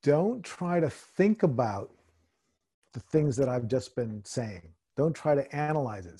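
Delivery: slow, calm meditation guide; guided meditation. Acted. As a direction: ease them into stillness. Leads a meditation.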